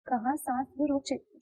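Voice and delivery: usual speaking voice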